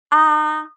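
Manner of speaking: ah held high and level; first tone ah. Shown as a demonstration. Teacher demonstrates first tone, high and level. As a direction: sing "ah" high and flat. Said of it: "high and flat".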